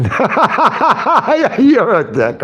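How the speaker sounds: cracking up as he says it; real laughter through the words; laughing. Real, not acted. Laughing at a chat joke.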